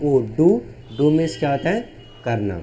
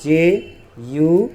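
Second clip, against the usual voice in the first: letters drawn out very slowly; slow spelling out; 3 dB louder, much slower than usual. Shown as a demonstration. Teacher spelling out slowly while writing. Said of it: "जैसे यहाँ देखिए".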